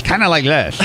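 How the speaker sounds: exaggerated slight lisp; dad's lisp impression. Shown as a demonstration. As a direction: imitate a lisping dad. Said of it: "a slight lisp thing going".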